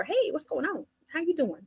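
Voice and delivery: high and soft, cheerful door-to-door pitch; cheery sales greeting. Retold or imitated. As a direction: greet the client sweetly at the door. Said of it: "I go knock on the door".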